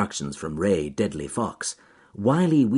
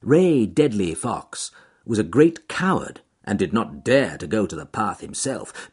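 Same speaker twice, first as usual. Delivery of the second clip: plain reading voice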